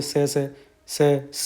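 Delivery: slow drawn-out "सै सै" hiss sound; voiced hiss demo. Shown as a demonstration. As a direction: stretch out "सै सै" like a snake. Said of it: "सै सै की आवाज़ आती है". ("सै सै" धीमी फुफकार)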